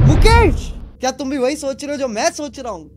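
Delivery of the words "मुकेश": sudden loud high cry of the name; startled shout. Real, not acted. Gamer suddenly recognising the name, startled shout. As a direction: jolt out the name in shock.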